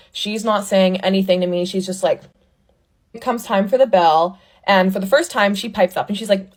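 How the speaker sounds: usual speaking voice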